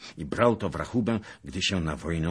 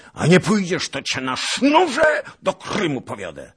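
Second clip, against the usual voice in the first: loud, high, raging threat; roared threat; 13 semitones higher, 10 dB louder than usual. A narrator's reading. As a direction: keep roaring as you threaten him. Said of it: "ryknął Tuchaj Bey".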